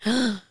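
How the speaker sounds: sharp gasp, then a short "ah"; quick intake and little yelp; startled gasp. Real, not acted. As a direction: gasp at a daunting question. Mock alarm at full marathon question.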